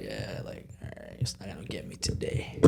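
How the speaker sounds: low, whispery muttering to oneself; acted quiet pep talk, dropped almost to a whisper; muted self-talk. Acting out brave self-talk under stress.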